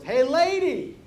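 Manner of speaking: rude parrot heckling a passer-by; parrot calling out. Acted. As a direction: swoop up on the greeting, drop for the jab. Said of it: "the parrot goes".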